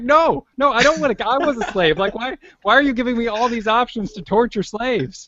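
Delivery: high-pitched exasperated objection; pleading protest. Retold or imitated. Player objecting to game's cruel options, high-pitched protest. Acting out distressed protest to game.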